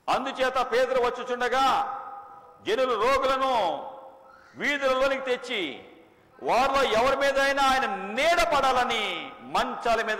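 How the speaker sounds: loud, high, slow pulpit proclamation; fervent preacher's cry; preaching at full force. Acted. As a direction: thunder it out to the congregation. Preacher reads scripture loudly.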